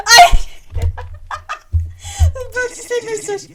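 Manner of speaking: high, loud, laughing through words. Real, not acted. Streamer laughing at her wrong guess: loud high laughter. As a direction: crack up mid-sentence.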